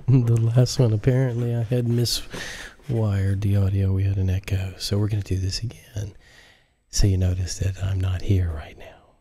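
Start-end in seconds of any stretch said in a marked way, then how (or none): 4.8-9.2 hushed whispered aside; soft unvoiced whisper. Real, not acted. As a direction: whisper it to the listener. Whispering intro to listeners.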